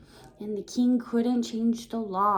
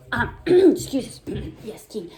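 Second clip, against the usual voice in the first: plain reading voice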